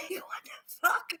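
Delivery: slow, breathy whisper; stunned whisper. Retold or imitated. Amazed at what she did in a minute, whispering slowly. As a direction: breathe it out, baffled. Baffled by own effort.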